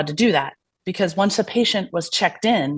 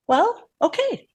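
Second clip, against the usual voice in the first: slow, much higher, sing-song okay; deadpan acceptance; 12 semitones higher, slower than usual. Retold or imitated. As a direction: shrug it off before walking out. Calm reply to rude assistant.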